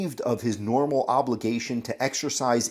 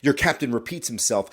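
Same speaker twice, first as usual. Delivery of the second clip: ordinary delivery, nothing marked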